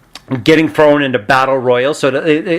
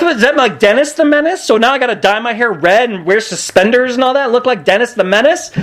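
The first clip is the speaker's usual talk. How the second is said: incredulous; 9 semitones higher than usual. Retold or imitated. Incredulous reaction to the name.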